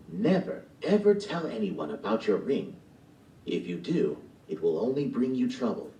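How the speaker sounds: put-on old woman voice. Old woman explaining the wish ring to Gigi, mysterious. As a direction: explain the magic ring, then a gentle warning.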